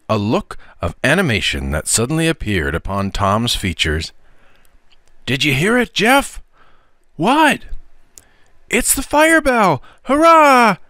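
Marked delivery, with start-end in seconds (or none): 5.2-10.8 high, loud, thrilled shout ending in a cheer; a boy who just heard the fire bell; excited boy. Acted.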